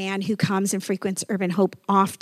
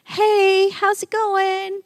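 much higher, slow bright hey how's it going; friendly greeting; 11 semitones higher, 4 dB louder, much slower than usual. Retold or imitated. Pastor greeting the man by the magnolia tree, high and bright. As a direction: call out warmly to the stranger. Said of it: "And I said".

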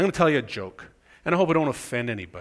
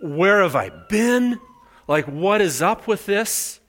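indignant disbelief; 6 semitones higher, 4 dB louder, slower than usual. Retold or imitated. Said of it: "I felt".

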